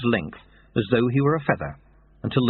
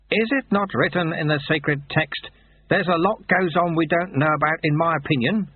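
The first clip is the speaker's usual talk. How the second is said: lightly voiced kindly old sweeper, higher; calm the boy gently; old monk, reassuring; 6 semitones higher than usual. Old sweeper reassures the dangling boy, lightly voiced kindly. Lutze quoting sacred text.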